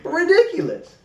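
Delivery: a high, incredulous "ridiculous"; fed-up scoff at the county rules; exasperated disbelief. Real, not acted. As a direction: throw up your hands in disbelief.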